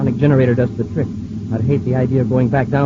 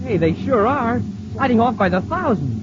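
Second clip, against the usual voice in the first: much higher, thrilled shout of relief; excited; 9 semitones higher than usual. Acted. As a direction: cheer as the bugs slide away. Crew sees insects falling off.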